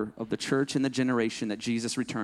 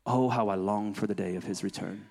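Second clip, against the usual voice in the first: hushed, breathy prayer to Jesus; soft whispered prayer, lower and much quieter; whispered longing; 7 semitones lower, 6 dB quieter than usual. Acted. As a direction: whisper it like a secret love note.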